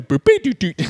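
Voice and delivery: high electronic-style vocal beeping; imitated synth beeps. Mocking the montage score, imitated synth beeps. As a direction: bounce through a silly synth riff. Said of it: "crazy synth music".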